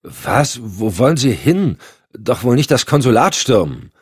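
startled rookie pleading; young agent Randall. Acted. Randall erschrocken. (junger Agent, erschrocken)

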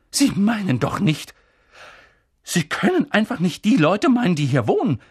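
loud, high, appalled exclamation; crying out. A narrator's reading. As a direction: call out in outraged disbelief. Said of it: "rief Professor McGonagall". (laut und entsetzt gerufen)

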